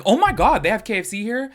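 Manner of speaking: high, loud delighted gasp; mock excited tourist. Vlogger voicing a first-time traveler, high and excited. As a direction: act out thrilled surprise. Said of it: "you're gonna be like".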